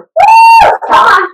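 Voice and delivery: high whooping cheer; loud excited woo. Real, not acted. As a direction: whoop to pump up the class. Trainer cheering the class.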